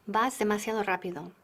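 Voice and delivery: usual speaking voice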